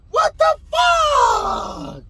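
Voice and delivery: very high, drawn-out cry of disbelief; shocked outcry. Retold or imitated. Man in his underwear finds police, shocked outcry. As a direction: wail it high and slow, utterly stunned.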